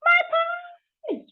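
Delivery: suddenly remembering the pie; sudden alarm. Acted. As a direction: blurt it out in a startled burst. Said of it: "she exclaimed".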